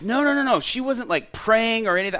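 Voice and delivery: high, loud mock protest; urgent protest. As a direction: object loudly, pitch jumping right up. Flustered organizer objects, retold.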